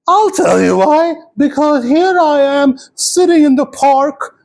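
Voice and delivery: put-on high-pitched, drawn-out old lady voice; high character voice for an elderly lady. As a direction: play an indignant old woman, high and slow. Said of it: "'cause she's old".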